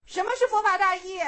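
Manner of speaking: loud, high, abrupt question; sudden challenge. A narrator's reading. Master suddenly questioning the weeping monk, loud challenge. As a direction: fire the question out at full voice. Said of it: "突然问他".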